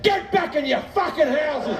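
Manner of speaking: high, strained yell, acted out; defiant mock bellow at onlookers; doubled-down shout. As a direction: shout the nosy neighbours away. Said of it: "I just doubled down and went".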